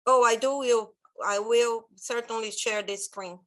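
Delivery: ordinary delivery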